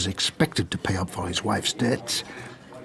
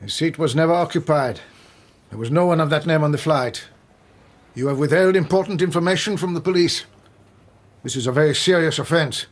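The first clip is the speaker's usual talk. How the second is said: raised, higher, hard-edged accusation; louder, higher warning of a serious offense; stern rebuke; 5 semitones higher, 4 dB louder than usual. Acted. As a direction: come down hard on the lying clerk. Inspector dressing down the clerk.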